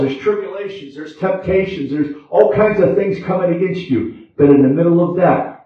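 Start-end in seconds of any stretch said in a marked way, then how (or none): none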